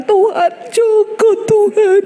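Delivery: high, slow, pleading mock prayer; mock fervent prayer. Preacher pretending to pray for a stranger's husband, high and drawn out. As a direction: ham up a desperate plea to God.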